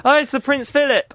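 high, excited eureka; excited realization. Real, not acted. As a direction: blurt the answer in triumph. Guessing game, sudden answer.

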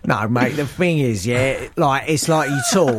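put-on London mate talk; exaggerated London accent. Host explains himself to guest, mock London accent. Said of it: "and not in that accent".

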